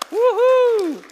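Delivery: high loud celebratory woohoo; excited whoop. Real, not acted. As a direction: whoop it up for the birthday. Church fifth birthday cheer.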